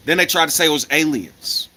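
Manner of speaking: ordinary delivery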